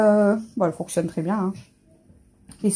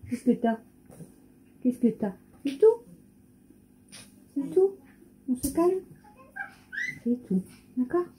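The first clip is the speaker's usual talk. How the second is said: soothing the cat; 6 semitones higher, 6 dB quieter than usual. Real, not acted. Gently soothing the worried cat.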